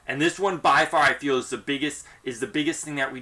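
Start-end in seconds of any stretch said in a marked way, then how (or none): none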